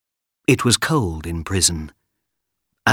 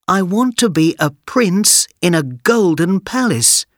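put-on high, excited boy's wish; eager boy; 10 semitones higher than usual. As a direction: wish big like a kid. Aladdin wishes.

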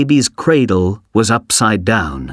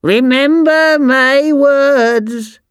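very high, slow, drawn-out curse; shouted witch's curse; 3 dB louder, much slower than usual. A narrator's reading. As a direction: fling the curse after him, stretching each word. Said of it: "shouted the old woman".

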